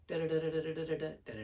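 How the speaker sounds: droning on one note, blah-blah; boring monotone. Shown as a demonstration. Coach showing what loses an audience, boring monotone. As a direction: drone without any variation.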